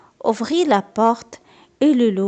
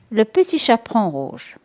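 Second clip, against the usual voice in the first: plain reading voice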